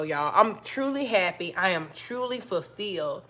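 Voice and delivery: usual speaking voice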